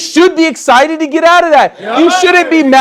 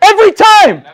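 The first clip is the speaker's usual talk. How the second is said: insistent repeat; 7 semitones higher than usual. Real, not acted.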